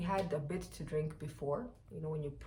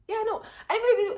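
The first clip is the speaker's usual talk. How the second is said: much higher and louder, through a laugh; 11 dB louder, faster than usual. Real, not acted. Laughing at own joke about Rwandan police guns.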